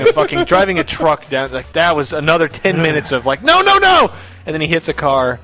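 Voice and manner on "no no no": high, loud cries of warning; alarmed shout. Retold or imitated. Watching repairman park his truck, panicked shout. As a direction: shout to stop someone at once.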